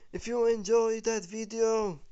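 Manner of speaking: high, loud, upbeat sign-off; excited outro. Real, not acted. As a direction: pump it up for the viewers. Upbeat outro call to viewers.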